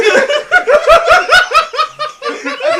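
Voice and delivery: howling with laughter; cracking up at the joke; loud laughing fit. Real, not acted. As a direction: burst out in a big loud laugh.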